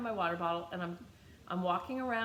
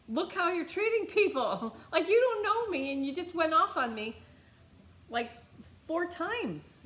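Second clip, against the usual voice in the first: louder, higher, laughing in disbelief; heated retort; 8 semitones higher, 5 dB louder than usual. Retold or imitated. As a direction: let her have it, half laughing. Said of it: "I said".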